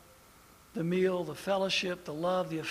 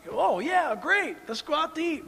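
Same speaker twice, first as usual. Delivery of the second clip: higher, mock-eager yes; a light imitation of an eager young preacher; eager young pastor; 9 semitones higher than usual. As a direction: jump at the offer. His young eager self.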